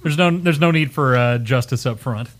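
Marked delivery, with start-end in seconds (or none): none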